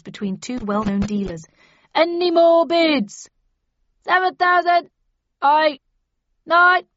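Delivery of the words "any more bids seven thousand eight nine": loud, slow auctioneer's pitch. A narrator's reading. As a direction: sell the lot to the room. Auction rising bids.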